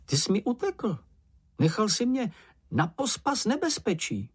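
high-pitched, aggrieved little voice; reproachful Čenich. Acted. Čenich reproaches Mumínek for running off, high aggrieved voice. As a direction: complain in a small high voice. Timid figure reproaches his companion.

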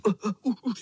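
high strained grunts under a weight; straining grunts. A narrator's reading. Bottom boy holding up the tower of boys, strained grunting. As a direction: grunt as if buckling under a load.

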